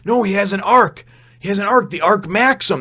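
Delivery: lightly acted urgent explanation; urgent reveal. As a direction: press the point hard. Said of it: "And he says".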